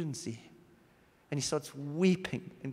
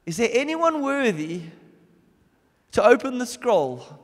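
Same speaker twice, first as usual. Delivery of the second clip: higher, louder proclaiming angel; angel's call; 5 semitones higher, 6 dB louder than usual. Acted. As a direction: call out over the whole earth. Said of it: "this angel looks out ... and says".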